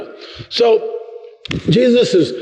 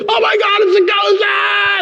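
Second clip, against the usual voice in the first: terrified shriek. Acted. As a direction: shriek in mock terror. Disciples scream at ghost.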